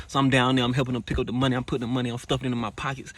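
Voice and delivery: ordinary talk, nothing marked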